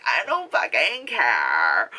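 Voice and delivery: slow, low, dragged defiance; defiant drawl. Retold or imitated. Sister brushing off waking the house, slow and defiant. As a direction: stretch it out, not caring. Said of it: "She's like".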